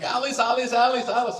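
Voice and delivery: high, called-out chant of the name; imitating a fan calling out from the street; fan yelling a name. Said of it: "he kept yelling".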